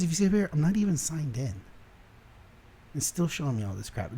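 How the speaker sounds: usual speaking voice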